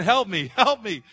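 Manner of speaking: loud, slow cry for help; mock drowning cry. Pastor acting a drowning person yelling, loud cry for help. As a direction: call out like someone drowning. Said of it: "out there screaming".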